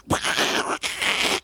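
noisy mouth-made espresso machine; imitated coffee machine. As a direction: imitate a hissing milk steamer. Imitating coffee machine making lattes.

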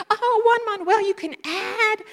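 high, loud, gushing praise; a mom thrilled at her son; delighted mother. Retold or imitated. Mother hears the commotion.

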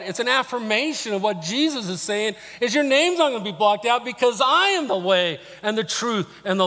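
high, loud, driving proclamation; impassioned preaching. Real, not acted. Preacher telling congregation their names are safe, impassioned. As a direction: hammer home the promise with conviction. Preaches at full force on assurance.

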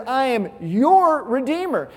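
slow I am your Redeemer, your pressed hard; preacher quoting God, slowly stressing your. Shown as a demonstration. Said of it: "I emphasize the word your".

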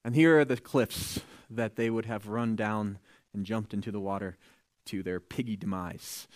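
ordinary talk, nothing marked

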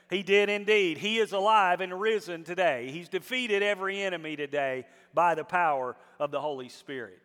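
loud, rising sermon climax; preaching at full force. Acted. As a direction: build the crowd up, loud and fervent.

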